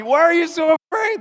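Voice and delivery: high loud disbelieving question; Jesus asking his scared disciples in disbelief; incredulous high question. Retold or imitated. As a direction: ask it amazed and loud. Voicing Jesus' question, laughing.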